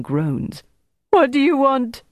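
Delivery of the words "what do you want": high-pitched anguished plea; imploring. A narrator's reading. Broken prisoner asks his torturers, imploring. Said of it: "Bertrand implored".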